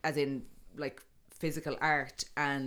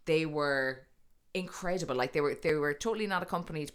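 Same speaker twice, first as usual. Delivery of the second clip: ordinary talk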